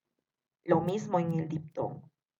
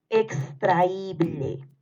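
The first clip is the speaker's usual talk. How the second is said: very slow, drawn-out "extraíble"; dictation; much slower than usual. Shown as a demonstration. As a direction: stretch out every syllable of "extraíble" for pupils writing. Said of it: "Te vuelvo a repetir". (dictado lento, alargando "extraíble")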